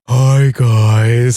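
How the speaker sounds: Juan impression. Cohost greets the listeners in a low, loud Juan impression. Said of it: "that's my Juan impression".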